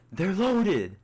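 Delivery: high, loud gleeful shout; excited yell. Acted. Teammate Alex yells about the loot, high excited shout. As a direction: yell it with glee. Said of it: "Alex, yell".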